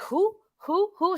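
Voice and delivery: high owl hoots; dazed DiCaprio hooting who. Retold or imitated. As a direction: hoot each who like an owl. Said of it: "Leonardo DiCaprio's like".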